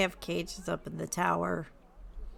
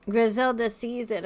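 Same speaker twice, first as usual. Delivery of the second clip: ordinary delivery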